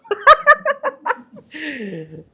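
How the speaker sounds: high burst of laughter; loud laugh. Real, not acted. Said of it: "నవ్వుతున్నావు". (గట్టిగా నవ్వు)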